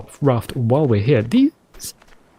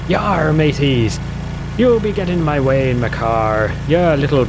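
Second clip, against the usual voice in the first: pirate talk. Retold or imitated. Retelling yelling at people from the car, mock pirate. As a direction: play a pirate hailing the mateys. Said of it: "talking like a pirate".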